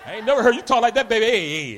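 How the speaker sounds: usual speaking voice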